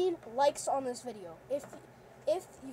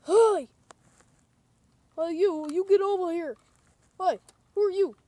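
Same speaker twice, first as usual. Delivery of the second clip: loud calling out across the street; shouting; 4 semitones higher, 13 dB louder than usual. Acted. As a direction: yell to get attention. Calling out to stranger.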